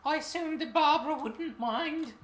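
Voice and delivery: high, prim British lady voice; a shaky British accent, pitched high. Acted. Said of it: "my British accent is not good".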